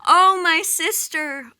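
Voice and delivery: high, loud grief-stricken wail, drawn out; wailing cry. Acted. Goddess in the underworld wailing for her husband, loud keening wail. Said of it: "she's crying out".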